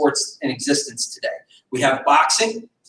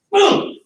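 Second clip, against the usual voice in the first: high, loud punchy boom; boom strike shout; 13 semitones higher, 3 dB louder than usual. Shown as a demonstration. Instructor shows the strike with a high, loud boom. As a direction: sell the impact.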